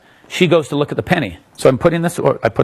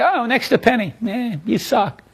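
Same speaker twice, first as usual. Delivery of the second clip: higher, deflated scoff at the secret; lightly voiced unimpressed spectator, higher pitch; mock disappointed spectator; 7 semitones higher than usual. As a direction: imitate a let-down audience member brushing it off. Unimpressed spectators after the reveal.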